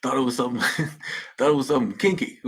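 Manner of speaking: words breaking into real laughter; laughing. Real, not acted. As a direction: laugh through the punchline. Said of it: "I don't know why that was so funny to me".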